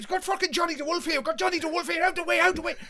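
high, loud, rapid hollering; urgent announcing shout. Retold or imitated. Lads passing off mate as famous, urgent shouting. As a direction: holler it as if escorting a star.

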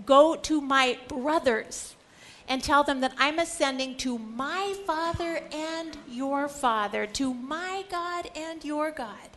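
slow, weighty commission; Jesus sending Mary to the brothers, solemn; solemn command. Retold or imitated. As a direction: lay each word down deliberately. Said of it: "this is really important what he says".

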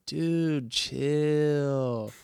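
put-on high, chill surfer-teen drawl; laid-back teen voice. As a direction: do a relaxed young turtle telling him to relax. Imitating the turtle's trailer line.

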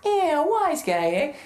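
high, slow tough-guy sneer; a cartoonish wise-guy kid. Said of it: "and he's like".

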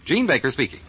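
ordinary talk

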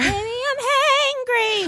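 put-on high, slow whiny baby voice; whiny hangry. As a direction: whine it like a hungry toddler. Whiny chant.

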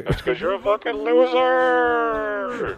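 very high, slow taunting ghost. Pretend. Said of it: "He's the different guy now".

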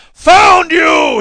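loud, high monster shout; screamed. A narrator's reading. Monster upon its prey, triumphant scream. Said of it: "he screamed".

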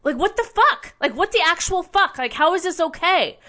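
high, incredulous outburst; shocked, can't believe what you saw; stunned outrage at the assault on the shopper; outraged disbelief. Real, not acted. Outrage at man being doused.

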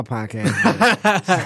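slow, laughing joke. Real, not acted. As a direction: laugh it out slowly.

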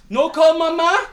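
put-on high, loud little boy's question; hopeful child. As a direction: ask like a kid bargaining. Child asking teacher.

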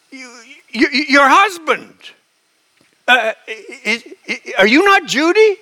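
halting, high-pitched dawning doubt; stammering confusion. Retold or imitated. Caller realizing she rang the wrong woman, flustered. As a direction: stumble and pause, rising to a bewildered question.

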